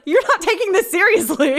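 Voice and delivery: high, loud exasperated protest; indignant. Acted. Woman to her amused boyfriend on the phone, indignant. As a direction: complain at being laughed off.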